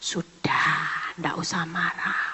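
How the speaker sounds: hushed, low whisper to calm someone; soothing whisper. Acted. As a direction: whisper it softly, as if patting a chest. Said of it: "saya cuman tepuk dadanya".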